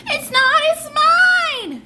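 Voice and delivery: the other twin yelling back; shrieking child retort. Acted.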